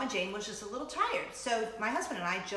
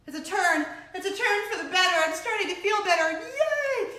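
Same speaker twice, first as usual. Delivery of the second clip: excited; 10 semitones higher, 9 dB louder than usual. Retold or imitated. Retelling own relief at recovering.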